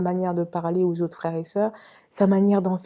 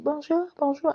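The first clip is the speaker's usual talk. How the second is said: mimicking a chirpy greeting; 8 semitones higher than usual. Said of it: "Elle avait un petit accent russe".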